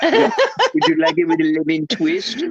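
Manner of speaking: a laughing imitation of Surge's odd accent. Friend quotes the espresso barista, laughing in his accent. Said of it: "the accent".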